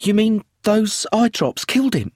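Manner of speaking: high and alarmed; shocked. A narrator's reading. Widow to inspector, high and shocked.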